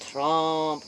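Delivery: usual speaking voice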